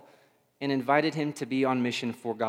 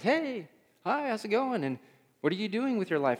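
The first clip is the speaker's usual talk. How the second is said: bright stranger; 8 semitones higher than usual. Retold or imitated. Mimics chatty stranger.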